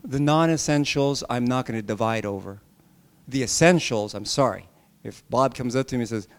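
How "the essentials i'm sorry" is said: normal talking voice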